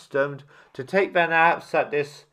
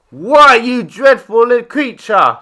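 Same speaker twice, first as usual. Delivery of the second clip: higher, louder scolding rebuke; reproachful; 7 semitones higher, 8 dB louder than usual. A narrator's reading. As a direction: scold the lion for his cruelty. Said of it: "said the tiger reproachfully".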